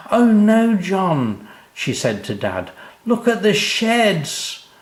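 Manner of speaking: high, loud shocked gasp of a mother; alarmed mum. Acted. Mum shows Dad the burning sheds, high and alarmed. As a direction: cry out in alarm, drawn out.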